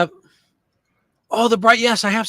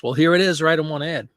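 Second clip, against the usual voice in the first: ordinary delivery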